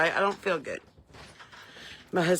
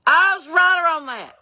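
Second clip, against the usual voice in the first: slow, drawn-out high holler; loud holler; 13 semitones higher, 9 dB louder, slower than usual. Retold or imitated. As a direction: holler it across the office. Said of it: "I hollered very loudly in the dentist's office".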